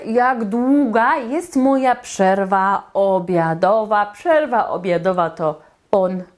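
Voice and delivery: normal talking voice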